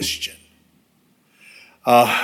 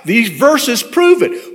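retold eager testimony, pitched up; raised, urgent, punchy proclaiming; passionate; 7 semitones higher than usual. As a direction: imitate a keen young believer spreading news. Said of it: "he just had that passion".

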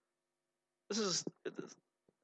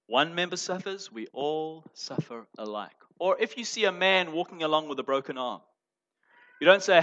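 ordinary delivery

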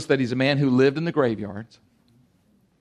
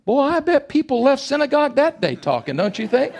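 usual speaking voice

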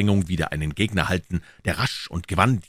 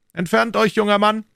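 high-pitched imperious order; commanding; 5 dB louder than usual. A narrator's reading. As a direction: order the youngster away in a raised voice. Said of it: "gebot Jussac".

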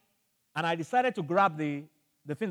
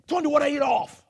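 urgent yelled order; 8 semitones higher, 6 dB louder than usual. Retold or imitated. Yelling order to wife.

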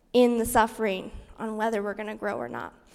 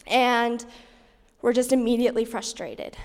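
ordinary delivery

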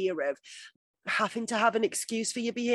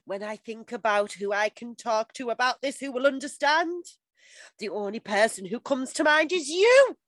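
Dawson monster voice; 3 semitones higher than usual. Pretend. Podcaster reads Dawson's love letter, rising monster voice. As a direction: let the declaration climb into melodrama. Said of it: "entire letter in Dawson monster voice".

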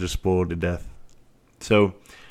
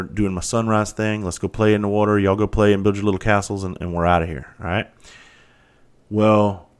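usual speaking voice

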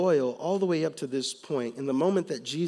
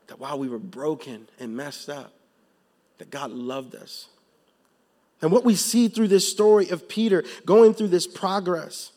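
normal talking voice

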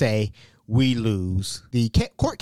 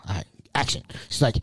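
ordinary talk, nothing marked

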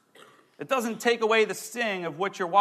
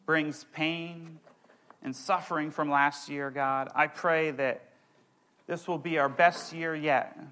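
closing prayer; 5 semitones lower, 4 dB quieter than usual. Acted. Pastor praying over the congregation, soft reverent prayer. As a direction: pray it gently over the room.

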